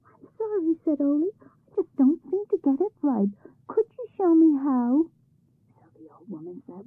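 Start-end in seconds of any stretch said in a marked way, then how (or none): 0.0-5.0 high, sweet mock-innocent boy; feigned innocence. A narrator's reading. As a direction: play dumb to trick the troll wife.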